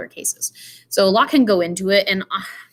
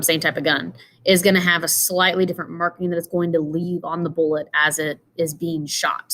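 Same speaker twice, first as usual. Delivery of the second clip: usual speaking voice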